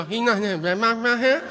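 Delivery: high, garbled Cajun question; mock a Cajun mumbling a question; unintelligible Cajun. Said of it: "Did you just ask me if there's a red box".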